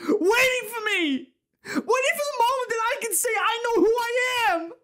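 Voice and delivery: very high, desperate pleading cry; anguished outburst. Acted. Office worker asking why he is here, anguished cry. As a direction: let the despair pour out at full pitch.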